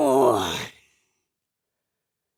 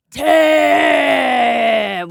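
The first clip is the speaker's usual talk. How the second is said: strained shout; 3 semitones higher, 8 dB louder than usual. Real, not acted. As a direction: grunt it out as the effort peaks. Last squat of ten.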